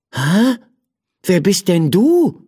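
a touch of wide-eyed surprise, lightly voiced man; astonished question. Man finding a creature in his slipper, mild astonishment. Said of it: "fragte er mit weit aufgerissenen Augen".

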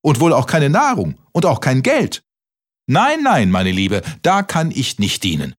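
high-pitched incredulous questions, then dismissive refusal; outrage peaking high on the noes; indignant mouse. A narrator's reading. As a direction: act an appalled mouse turning a beggar away. Shocked mouse refuses the cricket.

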